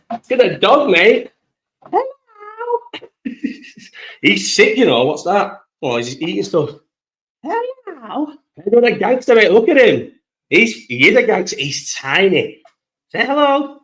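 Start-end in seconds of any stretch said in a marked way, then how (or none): 1.8-2.8 put-on much higher, soft baby-talk hello to the puppy; greeting the new puppy in a much higher doggy voice. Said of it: "That's my doggy voice". 7.4-8.3 put-on much higher, soft baby-talk hello to the puppy; greeting the new puppy in a much higher doggy voice. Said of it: "That's my doggy voice". 13.1-13.8 put-on much higher baby talk to the puppy; asking the puppy to say hello in doggy voice. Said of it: "That's my doggy voice".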